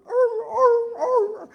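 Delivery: very high, loud yelps like a hurt dog; imitated dog yelping. Narrator shows the poor dog biting an electric eel, imitated yelping. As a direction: mimic a shocked dog's repeated yelps. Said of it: "that poor dog is just going".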